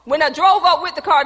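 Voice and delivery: ordinary talk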